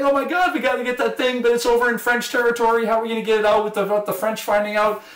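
high-pitched mock panic; hammed-up high worried rush of words; freaking out. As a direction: imitate someone fretting urgently, voice held high. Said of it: "he's freaking out about this".

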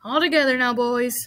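rally the men for a heave; rallying call. A narrator's reading. Farmer rallies men to lift.

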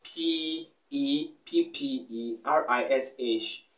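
slow, letter by letter P E P P E R I S H; spelling the word P E P P E R I S H slowly; spelled out letter by letter. Shown as a demonstration. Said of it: "using of that word".

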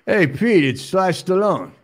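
loud, high phone greeting; calling out. Acted. Stallone phoning Pete about his wife, calling out loudly. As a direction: hail someone loudly down the line.

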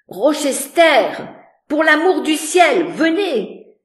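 high, loud, pleading scream upward; desperate cry for help. A narrator's reading. Voice from the room above begging Rochester, terrified cry. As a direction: beg for rescue at full voice. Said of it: "la voix implora encore".

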